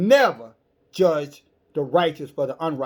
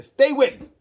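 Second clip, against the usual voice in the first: sudden call out; 11 semitones higher, 9 dB louder than usual. Real, not acted. Preacher grabs distracted listeners' attention.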